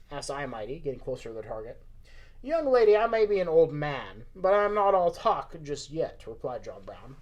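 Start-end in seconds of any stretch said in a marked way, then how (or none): 2.4-6.3 put-on loud Southern old-timer, pitched up and drawn out; Southern old man. Old man answers young companion's doubt, Southern accent. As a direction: push back in a Southern accent. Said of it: "Why have we made John Brown Southern?".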